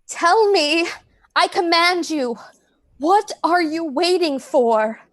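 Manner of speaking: high, insistent demand; urgent, commanding interrogation; desperate command. Acted. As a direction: order an answer, drawing out the question. Commands dying man to answer.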